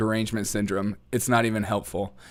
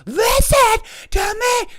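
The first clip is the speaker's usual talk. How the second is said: slow, much higher, louder screamed demand; screaming kid; 7 dB louder, slower than usual. Retold or imitated. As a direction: scream it like a frustrated child. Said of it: "they start screaming".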